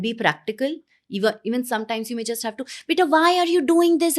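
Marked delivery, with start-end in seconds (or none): 2.9-4.2 acted snap, high and loud; exasperated parent. Parent to child before catching herself, exasperated. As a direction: pitch it up loud, like a parent losing patience. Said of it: "have to take a pause".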